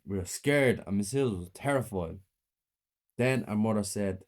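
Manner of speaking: ordinary delivery, nothing marked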